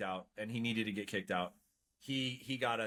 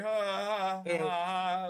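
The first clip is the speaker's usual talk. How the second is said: forced high ha ha ha; fake laugh; 7 semitones higher, 6 dB louder, slower than usual.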